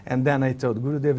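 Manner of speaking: ordinary talk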